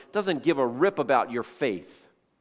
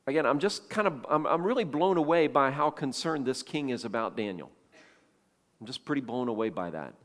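ordinary delivery

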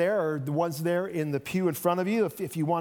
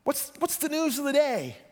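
Jesus asking, higher, offhand and casual; a traveler striking up chat with the disciples, lightly higher; 8 semitones higher than usual. Acted. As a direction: play him breezy, like a curious passerby.

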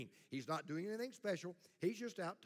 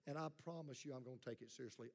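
a soft, hammed-up wounded churchgoer, faster at the end; mock hurt congregant; 3 semitones lower, 9 dB quieter than usual. Pastor mimics a wounded listener, soft mock hurt. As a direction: play the hurt listener, lightly mocking. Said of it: "if your heart's tender ... and you say".

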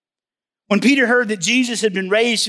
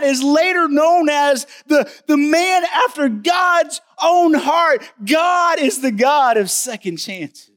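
preaching at full force; 5 semitones higher than usual. Real, not acted. Preacher to congregation about David, rising to a slow shout. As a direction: preach it to the rafters.

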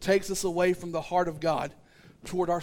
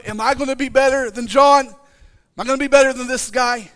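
anxious rival; 5 semitones higher, 9 dB louder than usual. Acted. Peter voiced, higher and louder.

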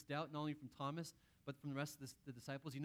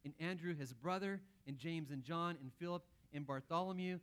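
normal talking voice

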